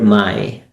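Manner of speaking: "my" set apart and lengthened, falling low; single out "my" as the key word; stress on MY. Shown as a demonstration. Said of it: "I'm emphasizing on this word".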